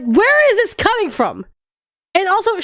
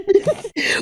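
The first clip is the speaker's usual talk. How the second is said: short laugh; 5 semitones higher than usual. Real, not acted. Laughs at own joke.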